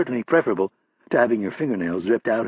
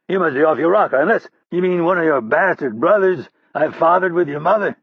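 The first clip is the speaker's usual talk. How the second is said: higher, louder crude jeer; insulting taunt; 6 semitones higher, 4 dB louder than usual. Acted. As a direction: rub his nose in it. Hurley insults Roddy.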